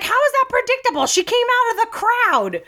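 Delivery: high-pitched mock outrage; indignant protest. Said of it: "she was like".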